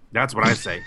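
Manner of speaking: ordinary delivery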